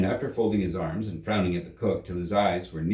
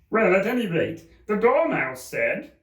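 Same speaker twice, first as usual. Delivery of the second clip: pleading Hatter; 11 semitones higher, 5 dB louder than usual. Acted. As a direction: beg for mercy.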